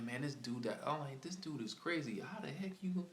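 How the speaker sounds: high, rising disbelief; this man is out of his mind; retold thought, marvelling at his nerve; incredulous.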